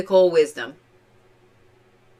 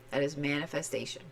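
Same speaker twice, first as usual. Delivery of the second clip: quiet repeat; 5 semitones lower, 14 dB quieter, faster than usual. Real, not acted. Tarot reader repeating warning.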